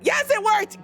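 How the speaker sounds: high-pitched burst of delight; excited relief. Real, not acted. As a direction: exclaim it as a sudden win. Shout-out command suddenly works.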